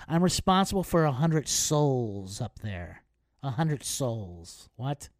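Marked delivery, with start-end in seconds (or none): 0.1-3.0 slow, self-important mock announcement; pompous gate agent. Gate agent lecturing delayed passengers, slow mock solemnity. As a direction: lightly mimic a gate agent full of his own gravity. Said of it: "you know what the flight gate said".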